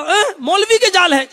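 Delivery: high, loud shocked question; the pigeon can't believe it; alarmed surprise. Acted. Pigeon shocked the net is a cleric's, high and loud.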